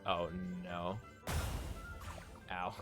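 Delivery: usual speaking voice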